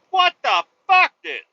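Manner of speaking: high, loud disbelieving shout; loud shocked yelp; shocked outburst. Retold or imitated. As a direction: blurt it out in horror. Reacting to fried guinea pig.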